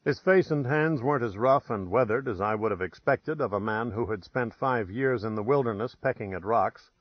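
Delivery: usual speaking voice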